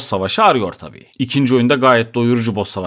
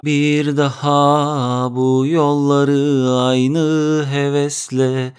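weary inner voice; much slower than usual. Retold or imitated. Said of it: "kısaca".

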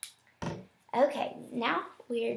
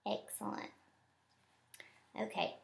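ordinary delivery

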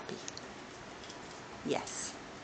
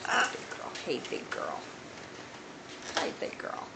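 hushed pet talk; 2 semitones lower, 6 dB quieter than usual. Real, not acted. Soft greeting to pet parrot.

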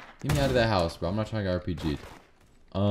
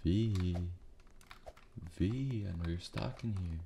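hushed; 8 semitones lower, 20 dB quieter than usual. Real, not acted. Hiding from V.

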